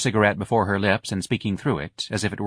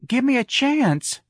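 slow, high-pitched plea; pleading; slower than usual. A narrator's reading. Darlene begging Lana mid-rehearsal, pleading. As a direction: plead for another try. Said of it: "Darlene pleaded".

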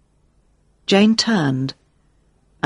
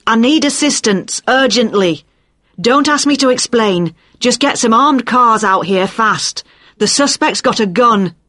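shouting; 5 semitones higher than usual. A narrator's reading. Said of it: "Jane screamed into it".

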